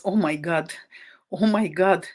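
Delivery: normal talking voice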